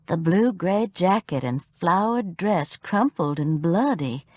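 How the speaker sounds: ordinary delivery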